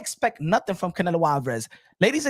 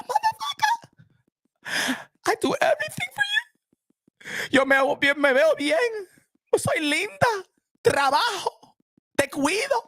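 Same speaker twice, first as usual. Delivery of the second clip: high-pitched wife, sobbing as she protests; crying wife; 10 semitones higher than usual. Acted. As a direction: wail it like a hurt wife who does everything. Wife yells back, crying.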